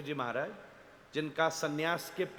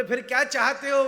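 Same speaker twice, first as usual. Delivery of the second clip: sharp challenge; 7 semitones higher, 7 dB louder than usual. Retold or imitated. Guru rebukes the well-wisher.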